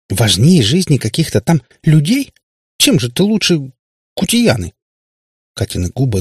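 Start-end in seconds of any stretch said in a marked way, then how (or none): 0.1-4.7 high, indignant reproach; горький упрёк. A narrator's reading. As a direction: accuse dad, hurt and outraged. Katya accuses dad, near tears. (с обидой и возмущением)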